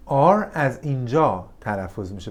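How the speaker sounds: ordinary talk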